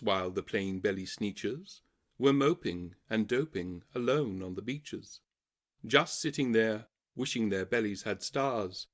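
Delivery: ordinary delivery, nothing marked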